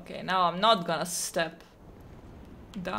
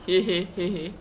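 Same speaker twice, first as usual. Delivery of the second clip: cartoon laugh. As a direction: play a sneaky cartoon giggle. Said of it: "Is Pot a trap?".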